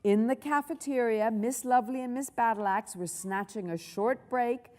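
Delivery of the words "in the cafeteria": ordinary delivery, nothing marked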